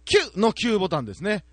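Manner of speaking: ordinary talk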